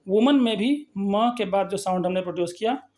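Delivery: ordinary talk, nothing marked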